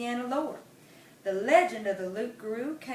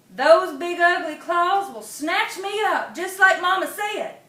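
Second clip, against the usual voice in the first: frightened gasp; 7 semitones higher, 9 dB louder than usual. Acted. Possum dreading the claws, high and scared. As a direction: panic as the claws come. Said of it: "Possum Mundus gasped".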